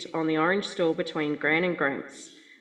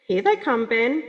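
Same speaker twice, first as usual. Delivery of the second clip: a touch higher, brighter as Gran; lightly voiced Gran spotting cows; Gran's call; 6 semitones higher, 4 dB louder than usual. Gran telling Ben the cows are coming, mildly higher. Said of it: "she'd say".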